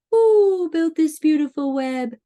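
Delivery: high, hooting owl question. Acted. Owl asks who built the web, high hooting voice. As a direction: hoot "who" long, carry it through. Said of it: "asked the owl".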